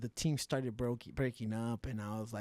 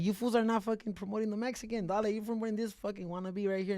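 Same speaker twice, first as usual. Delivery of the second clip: raised, higher retold complaint; indignant rant; 8 semitones higher, 6 dB louder than usual. Fighter calls out promoters for ignoring him, indignant. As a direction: throw shade at the promoters, voice pitched up. Re-enacting his shade at promoters.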